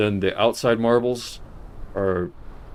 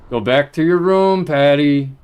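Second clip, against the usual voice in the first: shouting; 6 semitones higher, 8 dB louder than usual. Real, not acted. Repeated shouted order.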